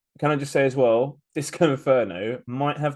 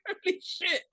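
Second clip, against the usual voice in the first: "Holy shit" laughed out, much higher and softer; laughing holy shit; 7 dB quieter than usual. Retold or imitated. Cohost retelling spotting the cosplayer, laughing disbelief.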